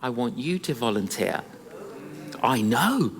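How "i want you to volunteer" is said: the Lord speaking. Retold or imitated. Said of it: "I felt the Lord say".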